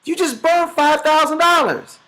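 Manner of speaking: high, shocked cry at the burned cash; stunned disbelief. Retold or imitated. Son sees his dad burn money, shocked disbelief. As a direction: play the son gaping in disbelief. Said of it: "the son is looking like".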